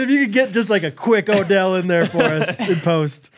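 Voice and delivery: normal talking voice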